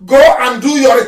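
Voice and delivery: ordinary talk, nothing marked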